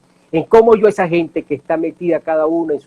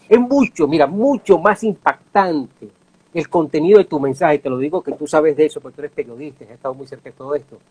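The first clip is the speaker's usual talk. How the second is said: usual speaking voice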